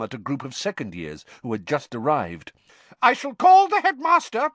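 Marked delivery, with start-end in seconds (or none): 3.0-4.5 high, loud stern threat; McGonagall shouting. A narrator's reading. Teacher threatens the poltergeist with the headmaster, shouting. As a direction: shout the warning upwards, pitched very high. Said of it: "shouted Professor McGonagall".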